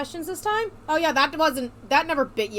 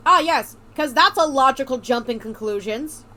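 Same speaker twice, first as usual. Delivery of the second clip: sarcastic; 9 dB louder than usual. Acted. Sarcastic reply to odd question.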